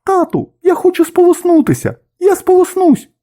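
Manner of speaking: put-on very high-pitched baby bird voice; squeaky small child-like gosling; little gosling. A narrator's reading. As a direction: pipe up like an eager gosling begging dad. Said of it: "вилізла на край гнізда і сказала".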